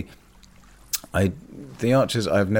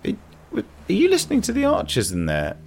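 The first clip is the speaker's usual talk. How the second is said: surprised question. Retold or imitated. Catching flatmate with the Archers.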